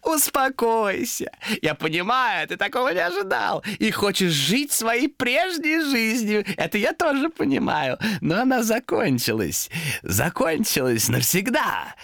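high, slow, drawn-out creature voice; calm him down with mock understanding; falsely consoling demon. Demon tells Томас his old life ended, falsely consoling.

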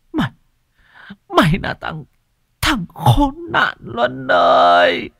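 high, loud sobbing lament; half crying. Acted. Old mother laments son sold everything, high and loud, crying. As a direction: plead through tears. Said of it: "nói như khóc".

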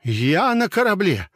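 high, loud desperate insistence; frightened high protest; panicked protest. A narrator's reading. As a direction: refuse to believe it. Disbelieving panic.

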